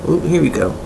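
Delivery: normal talking voice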